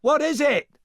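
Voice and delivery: high, loud call back; shouting. A narrator's reading. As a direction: shout across the water. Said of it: "shouted Shrek".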